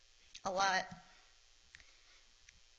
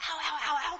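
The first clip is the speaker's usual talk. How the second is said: walking on hot sand; 8 semitones higher, 5 dB louder than usual. Retold or imitated. Feet burning on hot ground.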